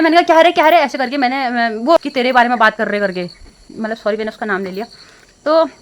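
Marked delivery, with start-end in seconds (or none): 0.2-0.8 raised, louder confronting cry of 'क्या रे'; college group को टोकते हुए ऊँची आवाज़; retold sharp call-out. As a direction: call them out sharply, half-replaying it. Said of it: "तो मैंने ऐसे ही बोल दिया".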